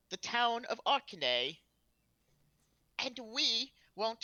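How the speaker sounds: imperious character voice addressing the enemy; commanding announcement. Acted. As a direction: proclaim an ultimatum with weighty pauses. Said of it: "announce in a commanding voice".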